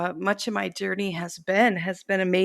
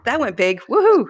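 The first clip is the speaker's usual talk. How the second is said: building to a loud, high woohoo; mock whoa rising to a whooping woohoo; gleeful cheer; 5 semitones higher, 6 dB louder than usual. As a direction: cheer it like a happy surprise win. Retells her own delight.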